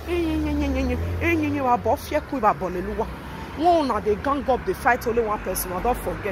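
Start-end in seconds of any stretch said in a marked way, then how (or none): 0.1-3.1 loud, high fake bawling; taunting imitation of sobbing; mock crying. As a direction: wail it loudly in mockery of a crybaby. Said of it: "Na now the one can dey cry".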